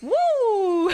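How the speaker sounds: high, loud woo; excited cheer. Real, not acted. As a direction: whoop it up. Hype cheer before starting.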